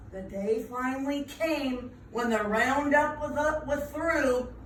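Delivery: plain reading voice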